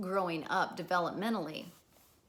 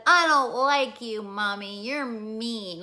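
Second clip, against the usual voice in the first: put-on pouty child; 3 semitones higher, 9 dB louder, slower than usual.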